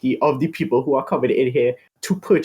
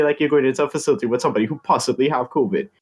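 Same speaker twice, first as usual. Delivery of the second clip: ordinary delivery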